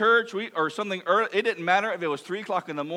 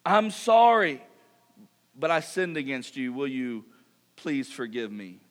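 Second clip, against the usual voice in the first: sincere plea; 6 semitones lower than usual. Shown as a demonstration. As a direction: ask forgiveness gently, voice dropping. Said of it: "we need to ask for forgiveness".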